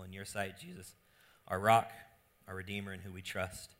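hushed, soft prayer voice. Acted. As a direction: pray it quietly to God. Opening prayer.

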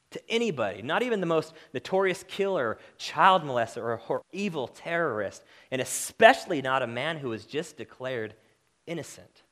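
loud, driving sermon voice; preaching at full force. Acted. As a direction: hammer the point home to the congregation. Pastor pressing the point hard.

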